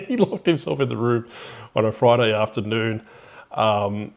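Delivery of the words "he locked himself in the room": laughing. Real, not acted. Retelling a prank on a friend.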